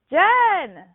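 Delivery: high, loud call of the name; imitated holler. Dad calls for the third time, imitated high holler. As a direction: holler like a dad wanting attention. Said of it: "hollering, trying to get my attention".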